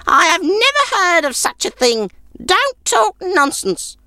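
put-on a stingy old woman warning him off; sour old woman. A narrator's reading. Old woman refusing the stranger a bed, sour high voice.

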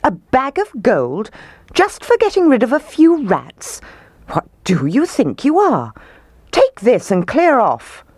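high, indignant mayor's tirade; outraged roar. A narrator's reading. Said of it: "roared the mayor".

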